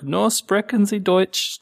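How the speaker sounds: slow, high-pitched halting German; mock clueless foreigner, drawn out and high; helpless tourist German. Tourist to a German-speaking security guard, slow and helpless. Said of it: "I did my, like".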